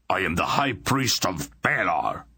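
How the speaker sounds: barely holding in rage through teeth; through clenched teeth. A narrator's reading. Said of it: "ground out between clenched teeth".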